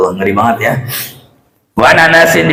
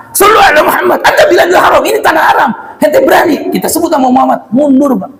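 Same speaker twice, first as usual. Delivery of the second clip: retold commanding shout; 14 semitones higher, faster than usual. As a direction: shout him down, much higher. Ustadz menghardik preman pemalak. (teriakan tegas mengusir preman)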